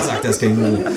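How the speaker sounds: ordinary talk, nothing marked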